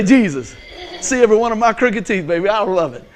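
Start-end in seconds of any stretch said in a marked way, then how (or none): 0.0-0.6 loud, joyful "Jesus" with a big smile; smiling "Jesus". Shown as a demonstration. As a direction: let the smile burst through on "Jesus". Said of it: "can't even and say it without smiling".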